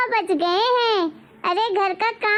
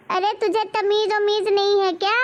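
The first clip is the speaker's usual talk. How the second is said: ordinary talk, nothing marked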